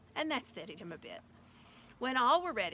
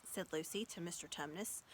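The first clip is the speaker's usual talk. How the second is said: ordinary delivery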